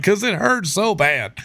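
high, slow whimpering plea; mock pitiful whine; whiny excuse. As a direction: whine it like a beaten kid. Whiny reply to drill sergeant.

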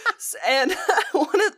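breaking into a laugh; giggling as she starts. Real, not acted. Laughing while retelling the coworker story.

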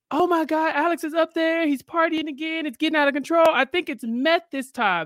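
high-pitched mock panic; frantic. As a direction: act out a frantic plea for help at the front desk. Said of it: "she frantically just tells the woman".

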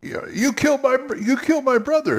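high, fast, outraged accusation; emotional accusation. Shown as a demonstration. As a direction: pour the feeling in, pitched high. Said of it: "Show it on your face".

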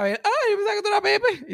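high, lightly voiced old woman; sweet old lady apologizing, high and a little loud; high grandma voice. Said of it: "Y después está".

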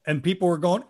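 ordinary talk, nothing marked

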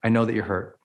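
gently name their hurt back; calm reflecting. Shown as a demonstration. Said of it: "it's saying".

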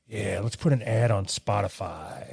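low, slow self-satisfied voice; smug voice. Retold or imitated. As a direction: purr it like a smug boss.

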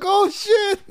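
high-pitched retold astonishment; amazed reaction. Fighter's friend retelling his surprise at the brother mix-up, high-pitched amazement.

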